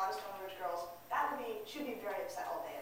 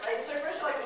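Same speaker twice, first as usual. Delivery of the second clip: ordinary talk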